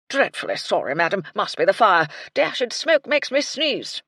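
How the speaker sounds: put-on a sheepish old soldier apologising; blustering colonel. A narrator's reading. Colonel apologising to the lady, blustering.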